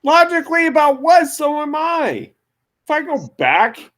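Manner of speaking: very high, loud squeal; hammed-up indignant shout; mock indignant outburst. Real, not acted. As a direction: fire back in mock offence.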